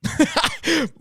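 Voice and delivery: high laugh. Real, not acted. Laughing at fan letter.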